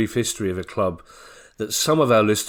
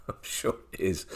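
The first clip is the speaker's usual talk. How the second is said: whispered laugh; 11 dB quieter than usual. Real, not acted. Laughs at co-host's joke.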